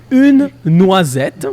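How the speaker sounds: slow, careful French model; each sound drawn out clearly; over enunciating. Shown as a demonstration. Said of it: "then you would say".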